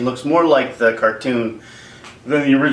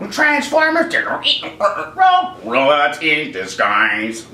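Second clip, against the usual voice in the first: attempted robot voice; much slower than usual. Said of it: "I don't do a robot voice".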